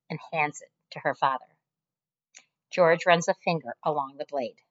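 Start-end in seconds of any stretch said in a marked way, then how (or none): none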